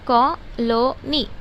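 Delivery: Colony very slowly in three parts, कॉ, लो, नी; divided into syllables. Shown as a demonstration. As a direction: pause after each syllable of colony. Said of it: "तीन तरह से अब जब हम word को divide करते हैं". (कॉलोनी को तीन हिस्सों में धीरे-धीरे)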